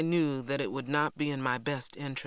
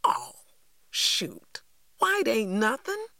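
put-on child's scoffing outburst, climbing high; exclaiming; 8 semitones higher, 4 dB louder, slower than usual. A narrator's reading. Cassie rejects Mama's explanation, exclaiming. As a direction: burst out dismissively, jumping up high on the last words. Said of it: "but I exclaimed".

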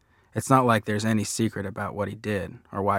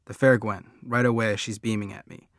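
ordinary delivery

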